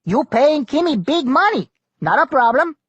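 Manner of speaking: high, loud pidgin-English navigator berating the pilot; Kimmy the navigator. A narrator's reading. Kimmy scolding Tuck over the storm, loud and high.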